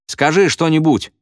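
high, eager pupil's demand; exclaiming. A narrator's reading. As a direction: call it out from the crowd. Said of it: "воскликнул какой-то ученик".

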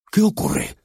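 high, breathy woman asking; lightly voiced woman. Woman asks a policeman what happened, high voiced.

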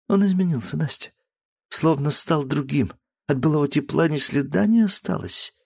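lightly acted sorrow; sad confession. Daughter confiding in her friend, sad.

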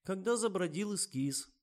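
high, resigned remark; sighing. A narrator's reading. Liza points out the flaw, sighing. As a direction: offer it with a weary sigh. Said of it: "вздохнула Лиза".

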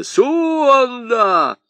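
high, loud call across the snow. Acted. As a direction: call him from far off. Аргылов зовёт слугу.

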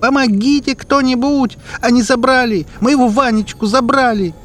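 very high, loud call for help; desperate scream. Acted. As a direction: scream for help with all your might. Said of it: "кричит, что есть мочи". (кричит что есть мочи)

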